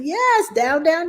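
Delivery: high, bright encouragement; excited praise to baby. Retold or imitated. Mother praising her baby girl's first word, excited and high. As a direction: cheer the baby on for trying.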